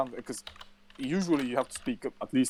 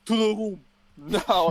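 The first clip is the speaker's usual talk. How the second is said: slow, louder commanding order; security guard ordering the man to the room; stern guard order; 8 semitones higher, 6 dB louder, slower than usual. Retold or imitated. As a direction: bark it like an armed guard. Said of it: "he was like".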